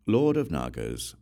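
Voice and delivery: ordinary delivery, nothing marked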